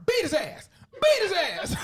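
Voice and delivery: high, loud playful yell; background heckling. Retold or imitated. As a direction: holler it from the background, half laughing.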